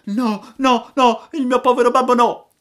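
high, loud desperate pleading; desperate cry. Acted. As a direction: beg for his father's life. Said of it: "gridò Pinocchio con accento disperato".

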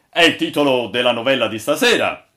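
loud, emphatic captain's announcement; exclaiming. A narrator's reading. Captain explains the sign to all, exclaiming. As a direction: call it out to the crew. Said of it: "esclamò il capitano".

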